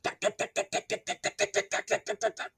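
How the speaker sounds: whispered duck duck duck, hands over ears; a muffled jackhammer behind covered ears. A narrator's reading. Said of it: "hands over my ears".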